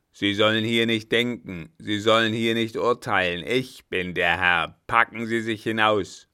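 loud, emphatic scolding; furious dismissal. A narrator's reading. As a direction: order him out at full volume. Old man furiously throws him out. (wütend und laut hinauswerfend)

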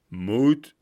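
loud, scornful cry; indignant shout. A narrator's reading. Vagabond offended by doubt, shouting. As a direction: fling the word back at him. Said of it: "rief einer".

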